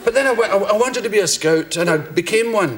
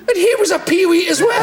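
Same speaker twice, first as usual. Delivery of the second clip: laughing punchline; 12 semitones higher, 4 dB louder than usual. Real, not acted.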